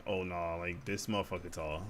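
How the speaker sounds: a hushed low marvel; mock hushed amazement. People meeting him in person, low hushed amazement. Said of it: "and they're like".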